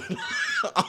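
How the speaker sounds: short, very high wheezy laugh; punchline laugh. Real, not acted. Storyteller revealing cards fell from his shoe, high laugh. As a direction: burst out laughing mid-phrase.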